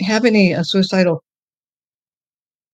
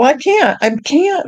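high-pitched, exasperated retold protest; mad protest; 9 semitones higher, slower than usual. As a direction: act out an indignant refusal, pitched well up. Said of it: "I was mad".

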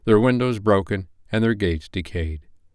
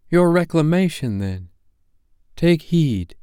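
higher, solemn ghostly warning; ghost of Christmas past; 9 semitones higher, 3 dB louder than usual. Acted. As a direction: speak as an otherworldly spirit giving a warning. Narrator voices the ghost.

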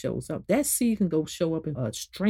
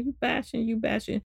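higher, softer mimicry of a nagging critic; mocking accusation; 5 semitones higher, 4 dB quieter than usual. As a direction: parody the complainers with a repeated jab.

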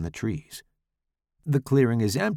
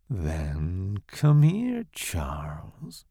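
very slow, sinking purr; seductive voice; 4 semitones lower, much slower than usual. Acted. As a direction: pull him in slowly. Seductress beckons.

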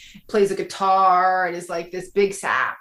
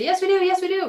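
much higher, insistent mock protest; mock eager women; 12 semitones higher than usual. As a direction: squeal it out like an eager fan. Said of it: "We'll say".

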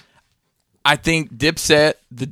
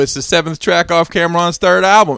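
louder, higher onstage taunt; mock boast; 4 semitones higher, 4 dB louder than usual. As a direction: announce it as a triumphant pretend diss. Acts out imagined boast onstage.

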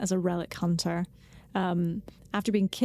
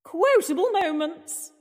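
slow, higher announcer echo of the title; 7 semitones higher, 3 dB louder, slower than usual. Acted. Co-host echoes the segment title in a slow announcer voice. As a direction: echo the segment name like a jingle. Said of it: "let's go on to quotable moments".

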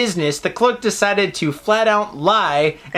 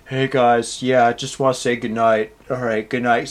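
low mock girl voice, lightly done; a hint of a sleepy hotel girl; imitating a girl; 8 semitones lower than usual. Retelling the hotel girl saying goodnight, low mock voice. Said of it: "she's like".

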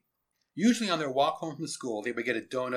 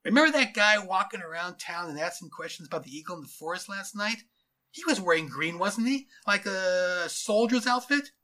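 higher, questioning officer voice; suspicious cop thinking back; puzzled policeman; 6 semitones higher, 4 dB louder than usual. Acted. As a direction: work it out aloud, questions lifting. Policeman's figure.

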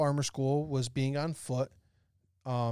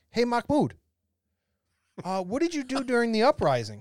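higher, louder call to a buddy; retold eager question; 7 semitones higher, 5 dB louder than usual. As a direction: put a curious question to a friend, pitched up. Friends quizzing Mahmoud in a story.

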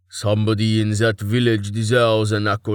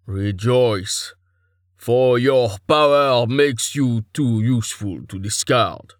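slightly raised, commanding god voice; mildly raised imperious pronouncement; slower than usual. A narrator's reading. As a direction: proclaim it like a god addressing a subject. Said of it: "He raised his voice".

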